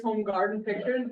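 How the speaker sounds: normal talking voice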